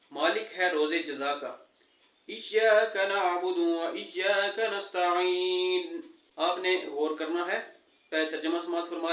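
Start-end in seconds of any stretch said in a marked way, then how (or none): 2.3-6.0 slow, drawn-out tajweed recitation; Quran recitation. Shown as a demonstration. Teacher reciting Fatiha to students, slow and measured. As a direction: recite each word slowly and carefully.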